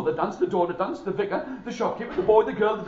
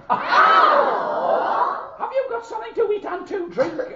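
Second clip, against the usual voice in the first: little man voice; 13 semitones higher, 3 dB louder than usual. Acted. Little man asks for food.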